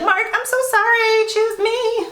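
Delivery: very high, fake-sweet begging; mock pleading. Woman turning back to her backup suitor, mock pleading.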